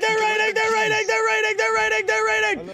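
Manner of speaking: high frantic repeated warning; panicked alarm. Real, not acted.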